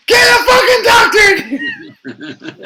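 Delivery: very high, loud, drawn-out shout; panicked yell. Retold or imitated. Father running down hospital hallway, panicked shout. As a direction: holler for help, half laughing.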